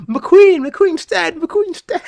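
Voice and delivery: very high, loud, hammed-up shriek; high mock hysteria; mock screaming. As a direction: shriek it over and over like a lunatic. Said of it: "you're still saying".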